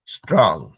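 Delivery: स्ट्रॉन्ग low with round vowel, strong; English pronunciation. Shown as a demonstration. Teacher shows students how the English say स्ट्रॉन्ग. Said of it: "सुनिएगा अंग्रेज लोग कैसे pronounce करता है इसको".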